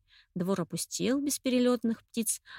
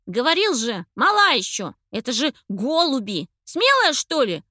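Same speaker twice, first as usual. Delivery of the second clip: angry scolding shout; 4 semitones higher, 8 dB louder than usual. A narrator's reading. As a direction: scold her loudly. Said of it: "разгневанно спросил Андрейка".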